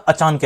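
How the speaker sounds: "अचानक" as ए-चान-कै, न joined to चा; wrong break. Shown as a demonstration. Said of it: "क्या हो गया". ("अचानक" को अ-चान-कै बोलें)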